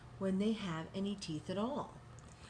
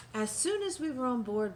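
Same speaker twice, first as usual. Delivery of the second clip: usual speaking voice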